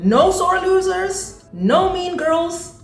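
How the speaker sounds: high, drawn-out singsong; high-pitched mocking chant; mock scolding singsong. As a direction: stretch it out high and slow.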